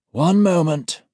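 calm authority; senior auditor voice. A narrator's reading. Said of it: "The senior auditor raises his left hand".

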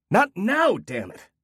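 father screaming at his daughter; screamed at child. Acted. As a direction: snap at the child in rage. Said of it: "he screamed".

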